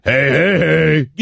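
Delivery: deep booming cartoon greeting; Fat Albert voice. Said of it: "[name]'s fake Fat Albert".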